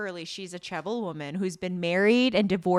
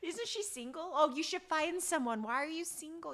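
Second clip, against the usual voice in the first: nosy busybodies; 9 semitones higher than usual. Retold or imitated. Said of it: "they're like".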